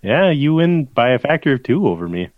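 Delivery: ordinary delivery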